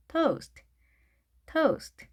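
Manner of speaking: toast as one high, falling syllable with gliding "oh-oo"; long vowel. Shown as a demonstration. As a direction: draw out the o in toast, keep st light. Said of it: "길게 발음하셔야 되겠습니다".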